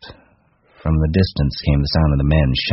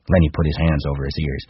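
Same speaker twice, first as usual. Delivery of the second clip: usual speaking voice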